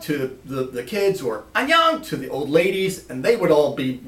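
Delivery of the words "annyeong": bright-pitched, loud called greeting; cheery hello. Retold or imitated. Lance greeting everybody in Korean, cheery high call. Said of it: "he would say hello to everybody".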